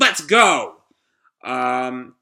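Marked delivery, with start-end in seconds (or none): 0.0-0.8 raised, higher-pitched rallying cry; excited call. Acted. Character rallying the team to leave, excited call. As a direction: call out to get everyone moving.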